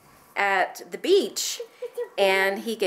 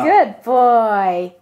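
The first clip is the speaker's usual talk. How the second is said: loud cheerful praise; praising; 8 dB louder than usual. Real, not acted. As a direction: cheer him on. Praising the baby.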